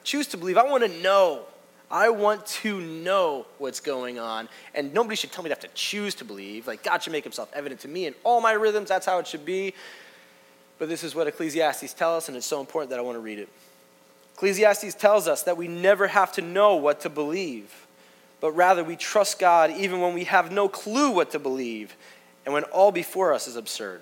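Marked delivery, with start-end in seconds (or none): 0.0-9.7 frustrated retort, acting out his old self; hating it. Reader objecting to the professor's advice, frustrated. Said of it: "I used to hate that". 14.4-24.0 loud, raised, forceful reading; emphatic declaration. Real, not acted. Preacher reading the important line to the congregation, emphatic. Reads key line, then repeats it.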